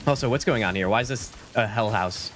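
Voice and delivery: normal talking voice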